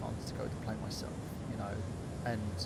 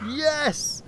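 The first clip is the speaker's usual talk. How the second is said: high, loud delighted yelp; excited yes; 13 semitones higher, 7 dB louder than usual. Real, not acted. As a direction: jump at the idea. Cheering the top hat idea.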